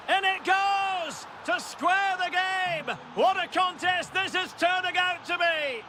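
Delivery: high, slow, shouted goal call. Acted. Commentator screaming the equalising goal, high and stretched. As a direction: belt out the equaliser.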